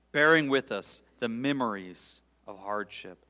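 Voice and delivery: loud, rising lament read to God; fervent psalm prayer. Acted. Preacher praying the psalm aloud before his congregation, pleading.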